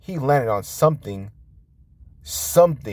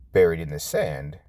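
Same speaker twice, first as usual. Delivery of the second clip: plain reading voice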